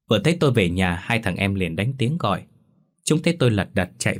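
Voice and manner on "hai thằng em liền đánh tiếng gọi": ordinary delivery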